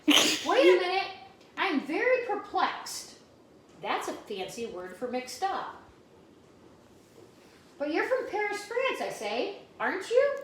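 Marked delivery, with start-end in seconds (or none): 0.1-3.2 high, loud puzzled exclaim then slow; perplexed surprise. Acted. Girl realising Robert isn't from France, high puzzled. 7.8-10.4 put-on high, disbelieving child voice; can't believe it, push up high; astonished question. Girl questioning Robert about Paris, high and amazed.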